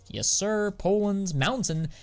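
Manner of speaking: ordinary talk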